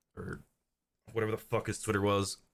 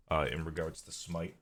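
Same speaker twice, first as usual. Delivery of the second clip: usual speaking voice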